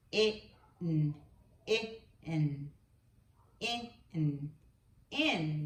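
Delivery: it, n said apart then blended to in; segmented then blended. Shown as a demonstration. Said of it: "Let's do another word".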